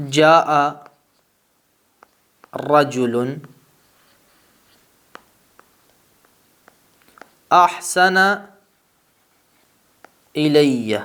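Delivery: جاء رجل احسن الی read word by word with long pauses between; slow careful Arabic reading. Shown as a demonstration. Teacher reading an Arabic example to students, slow and careful. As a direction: read the Arabic example slowly and clearly, each word set apart. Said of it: "آپ کہتے ہیں".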